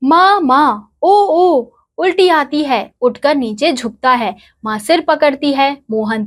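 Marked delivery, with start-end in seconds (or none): 0.0-2.8 put-on retching boy calling mother. A narrator's reading. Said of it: "फिर मोहन कहता है".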